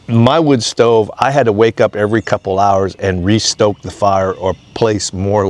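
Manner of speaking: ordinary delivery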